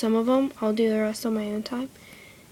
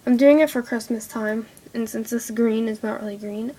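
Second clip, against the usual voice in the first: normal talking voice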